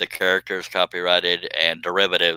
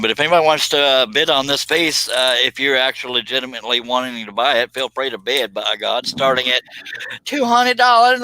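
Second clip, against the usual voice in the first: auctioneer voice; 4 semitones higher than usual. Acted. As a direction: sell it like an auctioneer. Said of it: "I'm just trying to do, sound like an auctioneer".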